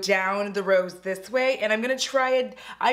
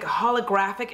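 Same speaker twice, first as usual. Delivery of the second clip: usual speaking voice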